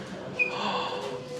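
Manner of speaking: sharp, soft, breathy gasp of delight; a quick unvoiced intake of surprise; excited gasp. Real, not acted. Spotting something in shop.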